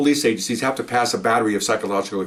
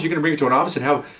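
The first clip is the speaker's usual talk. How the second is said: ordinary delivery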